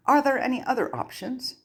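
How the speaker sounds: high, hopeful plea. A narrator's reading. Harry pressing the goblin, high hopeful question. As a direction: ask hoping for a way out.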